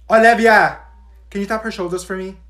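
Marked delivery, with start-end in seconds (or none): 0.1-0.8 loud, sharp call to a pupil; teacher's shout. Acted. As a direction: cut through the chatter with a shout.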